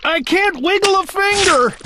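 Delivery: high, wailing complaint. Acted. Stuck trumpeter to Bartholomew, mild hammed-up wail. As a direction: let the despair ring out. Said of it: "the trumpeter wailed".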